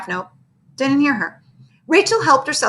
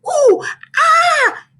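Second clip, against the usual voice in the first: high, loud cries of pain; high ooh and ah of pain; 6 dB louder than usual. A narrator's reading. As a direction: cry out in pain. Kids hit by drumstick.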